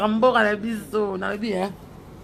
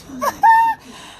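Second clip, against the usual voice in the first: shrill laugh; 7 dB louder than usual. Real, not acted. Laughing after a shoutout.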